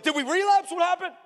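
high-pitched, worried outburst; alarmed question. Retold or imitated. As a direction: blurt it out in shock. Pastor acts out his alarmed reaction.

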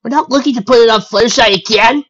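loud, high dismissive protest. A narrator's reading. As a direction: wave the worry away, loud and high. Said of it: "Rainbow said dismissively".

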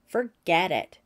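spurned and angry, he refuses her help. A narrator's reading. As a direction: throw it back at her in anger. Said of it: "He was so angry".

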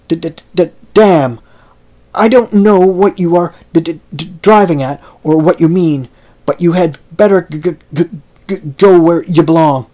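resentful stammer. Acted. Billy driving off the stranger, stuttering resentful outburst. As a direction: stammer hard on the first sounds in anger. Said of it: "his vocal infirmity somewhat intruded".

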